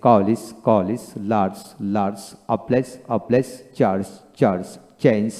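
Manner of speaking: each word said twice, final G as জ, paced for repeating; teacher pronunciation drill. Shown as a demonstration. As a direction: model each word slowly for learners to copy. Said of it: "তাহলে আমি যেভাবে পড়ি এইভাবে আপনি পড়বেন".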